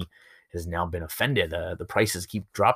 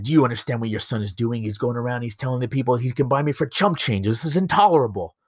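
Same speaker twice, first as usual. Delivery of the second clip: indignant, raised-pitch mock rant; acted outraged leader, climbing higher and louder; upset dictator; 5 semitones higher, 3 dB louder than usual. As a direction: scold the boy's father, building to outrage. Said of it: "Mussolini's just upset".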